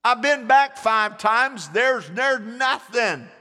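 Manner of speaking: high, loud protest; exasperated servant. Retold or imitated. Servant to Elijah, high and loud in protest. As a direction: protest that you've checked five times already. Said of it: "The servant said".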